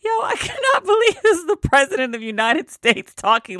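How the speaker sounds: can't believe what she just read; stunned disbelief. Real, not acted. Vlogger reacting to the president's words, high disbelief. Outraged disbelief at Trump quote.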